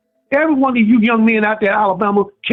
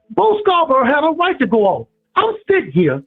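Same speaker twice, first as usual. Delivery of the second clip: ordinary talk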